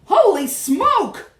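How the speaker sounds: loud, high, drawn-out yell. A narrator's reading. Cook feels mouse climbing his leg, yelling. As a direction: yell slowly in high alarm. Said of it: "the man was yelling".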